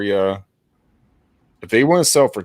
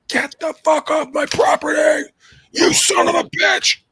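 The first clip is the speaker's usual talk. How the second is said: furious shout. Retold or imitated. Cranky old owner yelling at a realtor, furious shout. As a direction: bellow it like a cranky old man chasing you off.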